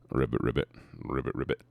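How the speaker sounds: low, croaked ribbits; frog imitation. Streamer plays along with a frog in chat, low frog imitation.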